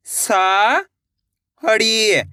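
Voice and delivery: drawn-out syllable by syllable; sounded out slowly. Shown as a demonstration. Teacher sounding out a word for pupils, slowly drawn out. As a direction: stretch each sound for the pupils. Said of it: "एक अक्षर का उच्चारण करके".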